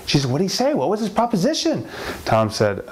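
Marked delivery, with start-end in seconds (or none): 0.3-1.9 high-pitched curious wife; mildly excited prying; eager questioning. Acted. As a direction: press him for it, voice pitched up.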